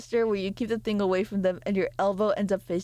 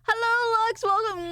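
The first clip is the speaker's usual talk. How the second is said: high, bright hello; excited greeting; 12 semitones higher than usual. Real, not acted. Greeting a new viewer.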